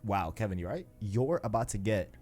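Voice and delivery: ordinary talk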